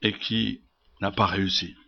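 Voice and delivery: soft, low, sober ending; hushed letdown. Acted. Preacher revealing her cure failed, hushed. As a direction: drop the voice after the big build-up. Drops after forceful build-up.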